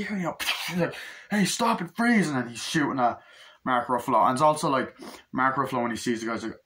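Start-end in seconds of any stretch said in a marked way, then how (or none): 1.3-2.3 high, loud police command rising; hammed-up detective yelling freeze; mock cop shout. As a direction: order him to freeze. Cop ordering Tom Cruise to stop.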